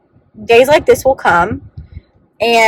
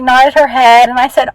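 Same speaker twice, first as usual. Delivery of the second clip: ordinary delivery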